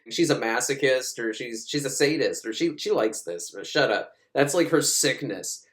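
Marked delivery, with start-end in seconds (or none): none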